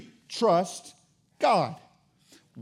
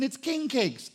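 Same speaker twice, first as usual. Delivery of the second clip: ordinary talk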